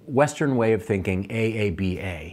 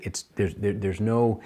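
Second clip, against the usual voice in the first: ordinary talk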